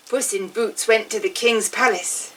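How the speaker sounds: usual speaking voice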